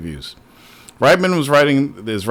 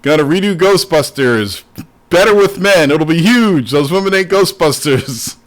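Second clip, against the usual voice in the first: Trump; 3 semitones higher than usual. Acted. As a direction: put on his voice, stretching the last phrase. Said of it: "Trump's voice".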